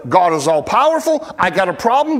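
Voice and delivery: ordinary talk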